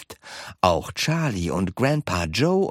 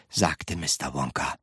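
usual speaking voice

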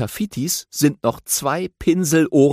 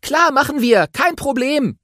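higher, eager little hero voice; friendly young aardvark, voiced higher; Tafiti voice; 13 semitones higher, 3 dB louder than usual. A narrator's reading. Tafiti politely asks the grumpy badger for help, eager and high.

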